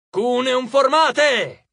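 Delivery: loud, high, very drawn-out order; yelled command. A narrator's reading. Frank ordering the legion into a wedge, yelled. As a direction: bellow the formation order to the troops. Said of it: "urlò Frank".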